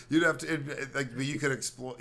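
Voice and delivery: ordinary delivery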